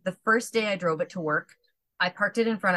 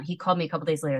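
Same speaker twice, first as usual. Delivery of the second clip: usual speaking voice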